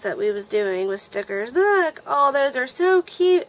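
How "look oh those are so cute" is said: high, loud burst of delight over a cute find; excited squeal. Real, not acted. Vlogger opening a friend's gift package, excited squeal.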